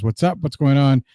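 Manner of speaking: ordinary talk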